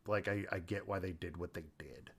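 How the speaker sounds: ordinary talk, nothing marked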